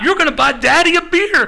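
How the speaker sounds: very high, loud mock announcement; hammed-up shouted demand from a pretend father; triumphant imitation. Tommy Rich claiming to be waiter's dad, excited imitation. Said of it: "And then he goes".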